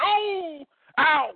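high mock cries of a crowd; imitating crowd hollering. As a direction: yelp like onlookers at each blow. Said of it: "the crazy crowd hollering".

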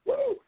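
high wrestling-promo woo, yelped out; Ric Flair woo. Retold or imitated. Host imitating Ric Flair in a wrestling promo, piercing high woo.

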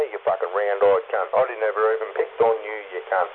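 usual speaking voice

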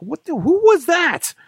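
high, loud, drawn-out bewilderment; mock startled outcry. Host retells hearing a strange announcer, mock startled yelp. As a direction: exclaim it way up high, hammed-up.

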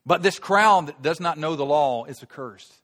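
loud, high, scornful challenge; haughty Pharisees. Acted. Pharisees berating their own officers, loud and haughty. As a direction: sneer down at them from your authority. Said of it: "And the Pharisees answered them".

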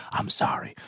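unvoiced, barely there; soundless mouthing. A narrator's reading. Woman apologising silently while on the phone, voiceless. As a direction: mouth it without voice. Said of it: "her lips said soundlessly".

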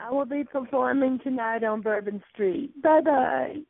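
high, sing-song camp "oh darling"; camp diva voice. Pretend. Teasing the caller in a high camp diva voice. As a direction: swoon it out like a vain diva.

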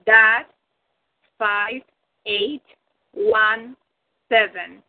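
slow, spaced-out spelling with long pauses; slow dictation. Real, not acted. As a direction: dictate letter by letter so they can write it down. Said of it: "say it very slowly so you can write it".

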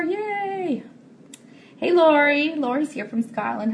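ordinary delivery

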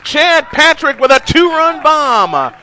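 rising, roaring home run shout; home run call. Real, not acted. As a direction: build from "going" to a full yell. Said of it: "hope you like that home run call".

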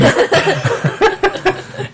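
high laugh. Real, not acted. Laughs after quote.

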